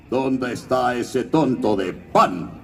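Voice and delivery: villano pirata lento y teatral; James Garfio. Shown as a demonstration. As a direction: pregunta con desprecio pomposo, arrastrando las palabras. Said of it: "Que decía". (voz del capitán Garfio)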